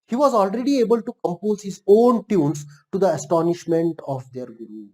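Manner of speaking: slow, deliberate read-aloud; reading text aloud. A narrator's reading. Teacher reads the English story to learners, reading aloud. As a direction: read it out slowly, phrase by phrase.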